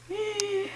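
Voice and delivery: quick frightened intake of breath; sharp gasp. Real, not acted. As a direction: gasp at the scary monster. Reaction to scary sketch.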